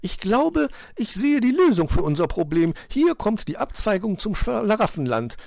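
high, loud triumphant bird; elated high magpie voice; delighted magpie. A narrator's reading. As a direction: announce the discovery joyfully. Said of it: "sagte die Elster hocherfreut".

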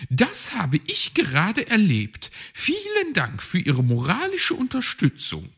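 high, sarcastic magpie grumble; grumpy. A narrator's reading. Magpie sulking at Herr Fuchs after losing, high and sarcastic. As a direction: sour thanks, dripping with sarcasm. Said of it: "war Frau Elster missmutig".

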